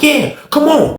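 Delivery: high-pitched imitated challenge; goading dare. Officer daring the inmate closer, high and heated. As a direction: goad him, voice way up.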